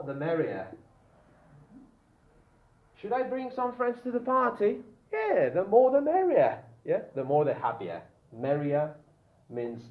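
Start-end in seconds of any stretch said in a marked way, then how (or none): 3.0-4.8 high, light asking voice; imitating a guest. Teacher voices a friend asking. 5.1-6.6 mock cheerful reply. Teacher voices the cheerful reply.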